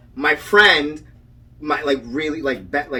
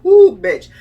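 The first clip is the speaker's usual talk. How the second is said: high, loud whew of rising nerves; reliving the panic of the stuck ride; worked-up exclaim; 9 semitones higher, 11 dB louder than usual. Real, not acted. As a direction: blow it out, worked up. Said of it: "I'm getting all worked up".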